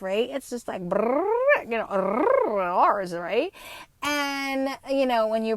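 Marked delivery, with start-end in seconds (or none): 0.8-3.1 rolled "brrr", pitched up; rapid Spanish trill. Shown as a demonstration. Showing how fast Spanish sounds, rolled-R imitation. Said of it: "the rate at which the Spanish language, it is fast".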